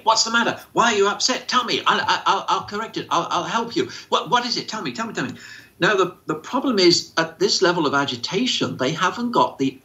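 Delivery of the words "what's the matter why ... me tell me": higher, pushy rapid-fire questions; mock interrogating carer. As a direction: badger them to explain. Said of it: "Please don't interrogate".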